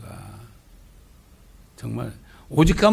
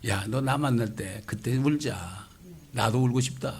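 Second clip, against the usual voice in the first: tender voice of the Lord; 3 semitones lower, 7 dB quieter, slower than usual. Retold or imitated. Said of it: "주님이".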